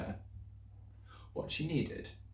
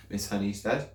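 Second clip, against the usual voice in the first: plain reading voice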